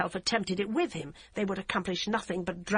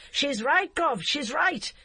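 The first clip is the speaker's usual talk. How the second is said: higher, loud urgent yell; 7 semitones higher, 4 dB louder, slower than usual. A narrator's reading. Constable yells at the DCI, higher loud yell. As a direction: yell it into her face. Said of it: "He yelled".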